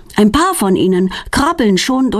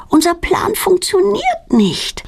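higher, slow, tearful complaint; near tears; 12 semitones higher, slower than usual. A narrator's reading. Girl whining to friend that their plan failed, tearful. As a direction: let the voice wobble with disappointment.

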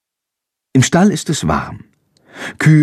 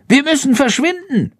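very high urgent bat order; commanding. A narrator's reading. Bat orders the boy away as the tractor arrives, commanding. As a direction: give the order in a high squeak. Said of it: "befiehlt Schandor".

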